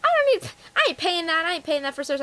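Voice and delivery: mock scoffing customer. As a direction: imitate a customer scorning the offer. Customers refusing the service plan.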